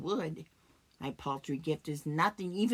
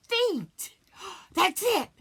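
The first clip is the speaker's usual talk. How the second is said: high, loud gasp of discovery; sudden realization; 13 semitones higher, 7 dB louder, slower than usual. Acted. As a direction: gasp and cry out the idea. Sudden idea at his feet.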